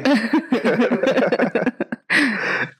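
long falling laugh; helpless laughter. Real, not acted. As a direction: laugh until breathless. Group laughing at the joke.

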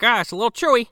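a hint of a guest chewing, unbothered; casual mock complaint about the food; mock diner. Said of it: "and he's like".

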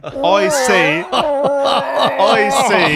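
lightly mock royal musing; Prince Charles voice. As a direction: slip into a posh Charles voice. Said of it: "I'm turning into Prince Charles".

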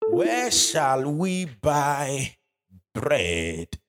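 drawn-out slow reading of the question; scripture reading. Acted. Preacher reading Jesus' question to Philip, very slow. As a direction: stretch each word of the question.

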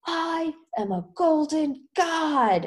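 high, drawn-out mock yell; retold triumphant yell. Drugged rocker proclaims from the roof, drawn-out mock yell. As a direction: stretch it out like a rooftop proclamation. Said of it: "he, like, yells it".

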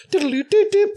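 high, far-off sing-song diddle-doo; high playful hummed melody; chopsticks tune hummed. Retold or imitated. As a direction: hum the chopsticks tune like a kid. Mocking chopsticks on piano.

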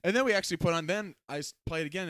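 usual speaking voice

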